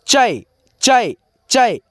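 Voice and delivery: चै drawn out very slowly as "chai"; slow sound demo. Shown as a demonstration. Teacher answers his own question about the ऐ sound, slow demo. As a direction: stretch चै out syllable by syllable so pupils hear "chai". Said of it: "इसका sound कैसे होगा?".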